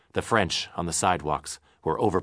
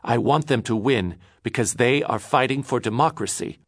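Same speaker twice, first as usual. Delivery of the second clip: heated; 6 semitones higher, 4 dB louder than usual. Acted. Angry quarrel.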